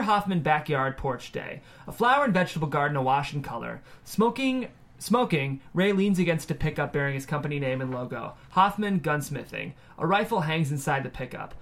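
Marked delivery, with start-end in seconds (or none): none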